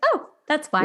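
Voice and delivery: dawning sarcastic realization; mock realization. Host recalling learning the guy was dating someone, knowing. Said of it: "And I was like".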